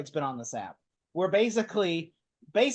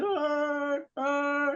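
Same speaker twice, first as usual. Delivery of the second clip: mock humping grunts; 9 semitones higher than usual. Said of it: "And he goes".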